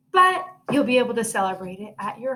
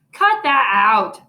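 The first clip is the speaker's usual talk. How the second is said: louder, slow, firm snap; annoyed protest; 7 dB louder, slower than usual. A narrator's reading. Peter telling Sheila to stop teasing, annoyed. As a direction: shut the teasing down. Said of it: "I said".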